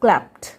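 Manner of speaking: क्लैप्ड ending "pt", as in "klapt"; final D as T. Shown as a demonstration. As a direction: finish क्लैप्ड on a voiceless t. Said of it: "sound भी ट आएगा". (क्लैप्ड को क्लैप्ट बोलकर)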